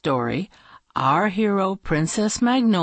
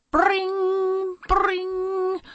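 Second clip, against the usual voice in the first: high ringing alarm imitation. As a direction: ring like an alarm bell. Alarm goes off at night.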